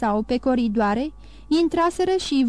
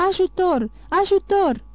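the nurse screams for help running to the door; panicked cry for help; 7 semitones higher than usual. A narrator's reading. Nurse crying for help at the bedside, panicked high shout. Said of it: "Sora a început să strige".

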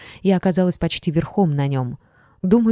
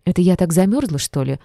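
ordinary delivery, nothing marked

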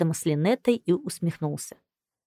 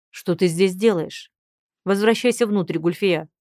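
stern order; 3 dB louder than usual. Acted. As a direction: order her back inside. Husband orders wife back inside. (строгий приказ)

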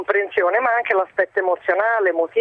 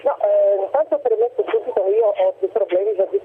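over the phone. Real, not acted. Caller on a bad phone line.

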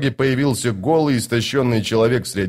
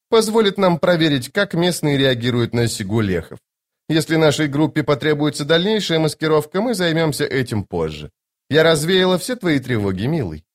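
high-pitched lady, dropping at phrase ends; playfully confident noblewoman, voice lifted high; self-assured sorceress; 5 semitones higher than usual. Acted. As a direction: speak as a lofty, teasing enchantress. Voices Lady Envy in dialogue.

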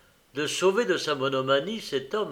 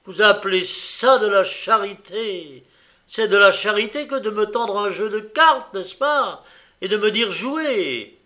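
louder and higher, bitter sarcasm; furious outburst; 8 semitones higher, 9 dB louder than usual. Acted. Host mocks the card-game idea, furious and louder. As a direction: hurl the mockery after banging the table. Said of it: "frappa la table d'un coup de poing terrible".

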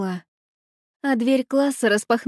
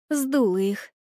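usual speaking voice

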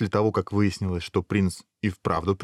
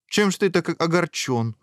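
higher, curious questioning; interested; 9 semitones higher than usual. A narrator's reading. Princess curious about the prince's sorrow, interested. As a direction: ask with growing interest. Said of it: "уже заинтересованно спросила".

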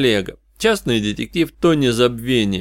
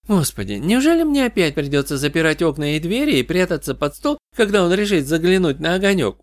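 higher-pitched, lightly voiced exasperation; Katie, exasperated; 7 semitones higher than usual. Katie dreads hiding from Walker again, exasperated. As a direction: complain in a raised, higher voice.

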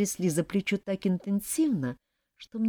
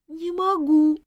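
high, louder whiny groan; 12 semitones higher, 5 dB louder, slower than usual.

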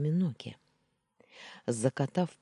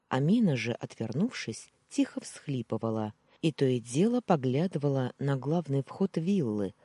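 ordinary delivery

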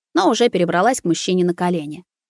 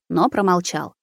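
plain reading voice

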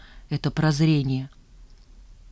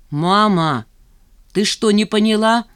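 louder, firm stern voice; 4 semitones higher, 5 dB louder than usual. Acted. Nina scolding her mother, stern. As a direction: lay down the law. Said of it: "строго произнесла Нина".

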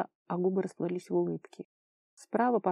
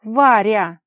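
very loud cry of her name; exclamation; 3 semitones higher, 14 dB louder than usual. A narrator's reading. Kolya cutting off Vara's tirade, exclaiming. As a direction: shout her name in protest. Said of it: "воскликнул Коля".

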